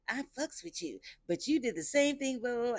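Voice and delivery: high put-on voice for the guy; mocking impression of a housemate; imitating him. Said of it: "He was like".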